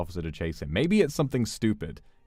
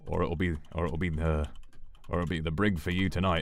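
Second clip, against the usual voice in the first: lower, lightly British, dismissive copper; British police officer; 4 semitones lower, 7 dB quieter than usual. Acted. Officer dismisses George in a low British accent. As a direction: brush him off like a bored bobby. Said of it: "The officer".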